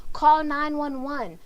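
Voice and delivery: urgent plea for help; near panic. Shown as a demonstration. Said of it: "I'm almost at a panic".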